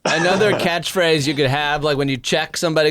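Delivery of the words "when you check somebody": ordinary talk, nothing marked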